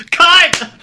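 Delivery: high, loud director's shout; called-out cue. Shown as a demonstration. Director ends the audition scene, called-out cue.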